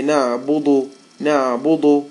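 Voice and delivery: نعبد said with a thick, heavy dal. Shown as a demonstration. Teacher imitating the common heavy dal. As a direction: make the dal in نعبد sound like dad. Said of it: "They say".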